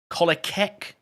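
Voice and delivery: "Kolacek" with a hard K before the final ek; guessed pronunciation. Shown as a demonstration. Streamer asks chatter how to say the name, trying "Kolacek" as KOLL-a-keck. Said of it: "Don't know how you pronounce that".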